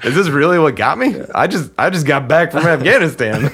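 laughing disbelief. Real, not acted. Veteran laughing to his friend about nearly dying by dryer. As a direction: laugh at your own near-death.

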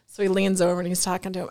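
normal talking voice

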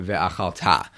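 ve'achalta accented at the very end, ve-a-khal-TA; stress on last syllable. Shown as a demonstration. Teacher returns to verse eighteen's odd form, final-syllable stress on ve'achalta. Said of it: "So that sounds weird if you're used to speaking Hebrew".

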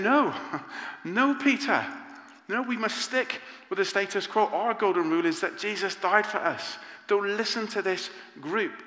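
emphatic Paul. Retold or imitated. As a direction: urge him firmly, with a laugh in between. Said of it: "imagine Paul saying".

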